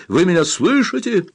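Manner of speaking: high-pitched shouted question; calling out. A narrator's reading. Rescuer checks trapped men can hear, calling out. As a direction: call through the noise to be heard. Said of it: "закричал Каммамури, что было силы".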